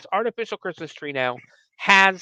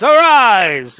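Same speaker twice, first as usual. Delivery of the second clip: higher and much louder, a hammed-up summoning cry; booming incantation; 6 semitones higher, 11 dB louder than usual. Witch summoning over the tree, a hammed-up booming cry. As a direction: bellow it like a sorcerer raising the dead. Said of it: "or a much more underwhelming way than that".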